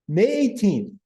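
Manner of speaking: high, drawn-out words through laughter; laughing. Real, not acted. Host restarting the history after his joke, laughing. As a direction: try to read the date while cracking up.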